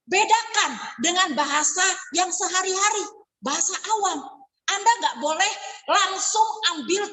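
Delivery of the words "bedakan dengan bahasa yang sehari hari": normal talking voice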